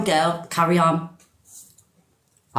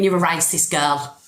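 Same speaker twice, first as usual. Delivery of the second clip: ordinary talk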